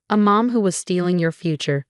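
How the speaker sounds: ordinary delivery, nothing marked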